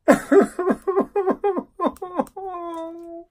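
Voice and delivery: long, high despairing cackle; high hysterical laugh. Real, not acted. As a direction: laugh helplessly, high and drawn out. Breaks down over the film.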